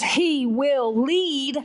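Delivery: slow, deliberate verse; measured reading aloud; solemn scripture reading. Retold or imitated. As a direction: space out the words of the promise. Reads another verse aloud.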